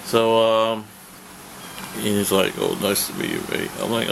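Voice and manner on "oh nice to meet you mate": a much lower, lightly voiced Travis on nice to meet you, mate; Travis impression. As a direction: drop low to do Travis greeting a fan. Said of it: "let me do his".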